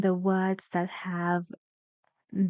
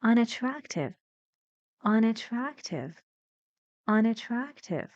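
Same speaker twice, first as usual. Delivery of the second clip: "Unattractive" with some stress on "Un", more energy on "trac"; higher start on "Un", firm "trac", falling weak ending in "Unattractive"; primary and secondary stress; 3 semitones higher than usual. Shown as a demonstration. Teacher shows students the two stresses, "Unattractive" with "trac" given more energy. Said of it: "I say this with more energy".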